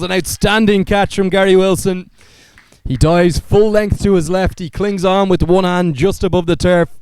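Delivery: high, loud live call of a catch; excited cricket commentator. Real, not acted. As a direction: shout the wicket as it happens.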